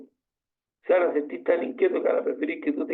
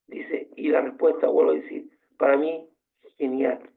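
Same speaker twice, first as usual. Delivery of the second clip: ordinary delivery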